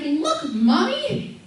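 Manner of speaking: put-on slow, high child's voice; little daughter. Toddler showing her mother a drawing, sweet and eager. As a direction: mimic a proud toddler. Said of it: "She's like".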